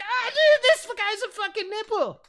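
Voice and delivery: high-pitched, loud jeering friend; mocking child. Child's friend joining in on the jeer, mocking shriek. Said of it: "and then he was like".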